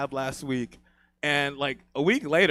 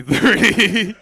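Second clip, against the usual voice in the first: laughing loud punchline; 5 semitones higher, 10 dB louder than usual. Real, not acted. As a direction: burst out laughing on Three.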